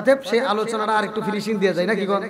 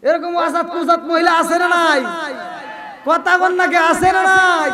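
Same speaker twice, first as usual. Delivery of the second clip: demanding shout; 7 semitones higher, 6 dB louder, slower than usual. Real, not acted. Preacher demanding crowd answer.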